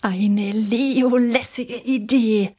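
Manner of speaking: langsam und cool; lässig gedehnt. Acted. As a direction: lang gezogen, entspannt. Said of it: "findet Matze". (gedehnt lässig)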